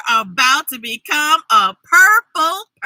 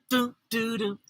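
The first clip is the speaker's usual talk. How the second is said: ordinary delivery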